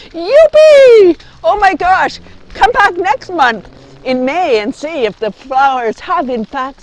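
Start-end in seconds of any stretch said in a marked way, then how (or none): 0.1-1.3 high loud cheer; loud joyful youpee; excited whoop. Real, not acted. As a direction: yell it with joy.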